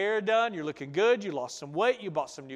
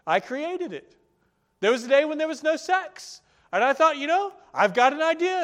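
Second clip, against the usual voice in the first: higher, louder playful voice of God; a hint of a knowing, amused God; lightly voiced God, raised and brighter; God answering; 9 semitones higher, 8 dB louder than usual. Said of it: "And God's like".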